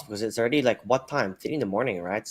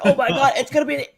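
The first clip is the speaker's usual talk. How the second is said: fast, high mock ace call; hyped ace call; 13 semitones higher, 7 dB louder, faster than usual. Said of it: "I'm gonna be like".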